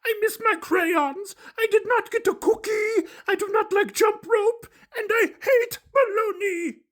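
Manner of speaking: high, loud monster voice, sobbing out complaints; high puppet wail, ending in a shouted hate; crying Grover. Acted. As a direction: list every woe through tears, building to a shout. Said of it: "cried Grover".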